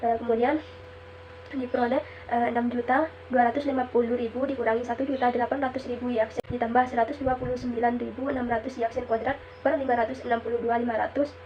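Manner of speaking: ordinary talk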